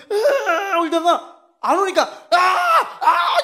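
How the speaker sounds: loud, high mock wail, laughing; a child howling for his mother, hammed up; little brother wailing to get mom's attention, hammed-up cry; wailing yell.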